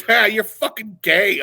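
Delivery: loud, high, drawn-out taunt; mock jeer. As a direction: imitate a gleeful, mocking put-down. Retells her jeering reply.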